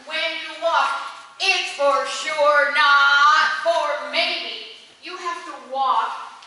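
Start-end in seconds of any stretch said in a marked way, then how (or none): 1.4-4.7 "not" slow and louder, falling firmly; confident stress on not. Shown as a demonstration. Director showing the choir a confident "not for maybe". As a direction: push "not for maybe" home slowly. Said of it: "walk having more confidence".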